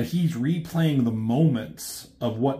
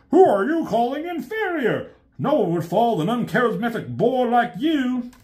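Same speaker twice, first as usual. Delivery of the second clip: indignant robot retort; 11 semitones higher than usual. Retold or imitated. Constructicons shouting back.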